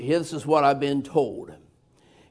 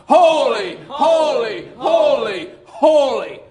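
shouted holy; men calling holy together at full voice; outdoor man voice; 6 semitones higher, 4 dB louder, slower than usual. Acted. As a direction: shout it like seraphs around the throne. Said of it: "use your man's voice, outdoor man voice".

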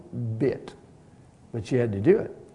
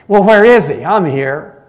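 loud, high demanding call; impatient demand; 6 semitones higher, 15 dB louder than usual. Retold or imitated. Impatient teenage girl demands to see the pastor, loud. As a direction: demand it across the office, fed up.

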